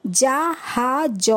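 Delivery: জাহাজ stretched out very slowly for the class; slow spelled-out reading. Shown as a demonstration. Teacher modelling for pupils, very slow drawn-out জাহাজ. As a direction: sound out জাহাজ at a crawl. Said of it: "যেরমভাবে আমি পড়লাম".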